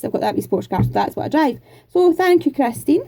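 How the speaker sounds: ordinary delivery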